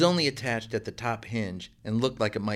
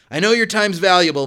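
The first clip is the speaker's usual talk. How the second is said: higher and louder, calling from the kitchen; called out; 8 semitones higher, 11 dB louder than usual. A narrator's reading. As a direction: call it out from the other room. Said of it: "Mister Christopher called out".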